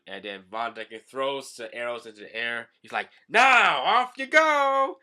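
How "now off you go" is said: high, loud, slow launching cry; gleeful shout. Acted. Von Decken sending arrows after Hachi with a gleeful shout. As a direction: fling it out with glee.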